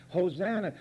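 ordinary talk, nothing marked

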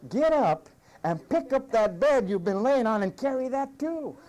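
a lightly voiced stern Jesus; commanding Jesus. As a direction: order him up without pity. Jesus answers the lazy man.

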